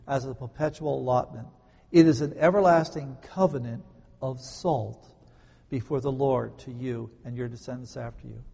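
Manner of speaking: measured, emphatic Bible reading; scripture reading. Acted. Pastor reads the covenant verse aloud, solemn reading. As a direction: proclaim the verse with weight.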